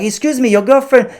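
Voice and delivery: put-on high, odd French-via-India accent; French-Indian accent. To the tourist at the airport, high mangled French-Indian accent. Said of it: "That's like a, a French guy who's lived in India".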